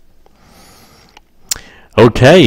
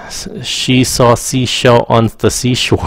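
tongue twister; slower than usual. Shown as a demonstration. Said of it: "I hate that".

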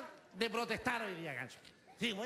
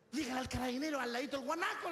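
lightly voiced comadre, slightly higher; acted comadre; 4 semitones higher than usual. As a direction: urge your friend on, pitch climbing at the end. Acts one comadre advising the other. (comadre insistente, tono algo más agudo)

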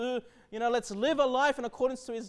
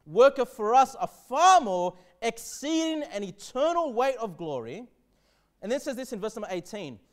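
forceful scripture reading; 2 semitones higher, 6 dB louder than usual. Acted.

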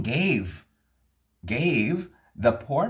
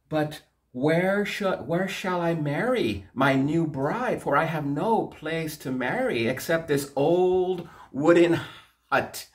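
worried poor man; 8 semitones higher than usual. Acted.

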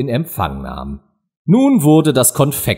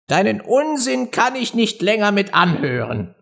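high, fed-up complaint; exasperated mouse; 9 semitones higher than usual. A narrator's reading. Mouse walks off from Alice's nonsense, exasperated.

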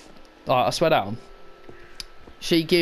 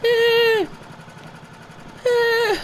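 mock scream; 4 dB louder than usual. Fake scare noise.

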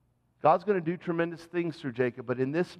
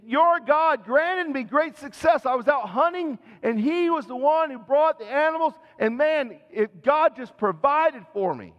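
boastful Jacob; 9 semitones higher, 3 dB louder than usual. Acted. Jacob boasting to his blind father, eager and showy. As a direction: sell the lie with pious enthusiasm.

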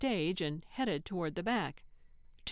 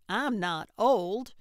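slow, louder, offended denial; affronted; 3 semitones higher, 6 dB louder than usual. A narrator's reading. Old lady objects to being called old, affronted. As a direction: protest each word with wounded pride. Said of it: "Gertrude gasped".

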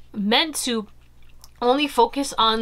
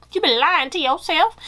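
higher-pitched, put-on voice for emphasis; exaggerated callout; 7 semitones higher, 4 dB louder than usual. Real, not acted. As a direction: call them out in an exaggerated high voice. Playful emphatic repeat of the line.

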